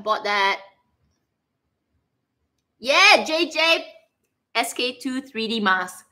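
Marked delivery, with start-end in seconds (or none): none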